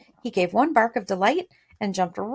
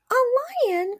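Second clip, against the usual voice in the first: alarmed; 4 dB louder than usual. A narrator's reading. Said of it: "cried the little queen".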